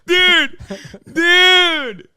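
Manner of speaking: high squeal through laughter; helpless laughter. Real, not acted. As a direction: gasp it out while cracking up.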